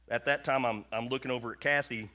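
ordinary talk

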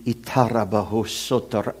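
quick, soft glossolalia; speaking in tongues. Retold or imitated. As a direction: mumble fast in tongues. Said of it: "why are you speaking in tongues?".

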